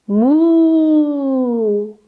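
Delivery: long, slow mooing like a cow. Shown as a demonstration. Teacher mooing for the kids, drawn-out cow moo. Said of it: "Come back into cow".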